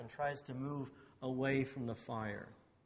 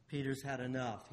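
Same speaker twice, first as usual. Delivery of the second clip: ordinary talk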